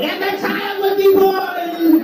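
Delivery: high, slow, shrieking witch voice; little witch. Acted. Little witch prophesying, a high slow shriek.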